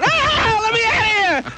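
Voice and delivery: high, strained mock panic, well above his voice; panicked squeal. Retelling the hitchhike ride, squealing to be let out. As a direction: yelp it like a scared kid wanting out. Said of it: "I said".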